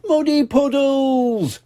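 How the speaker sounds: high slow joyful cheer. Acted. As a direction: cheer it out with delight. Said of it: "cheered Pepper".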